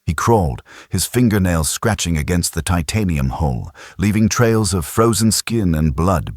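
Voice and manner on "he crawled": plain reading voice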